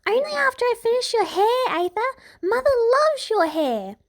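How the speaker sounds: put-on high, insistent childish voice; demanding little girl. A narrator's reading. Little girl insisting to the guard, high and demanding. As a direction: demand it like a stubborn five-year-old. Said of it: "Branda demands".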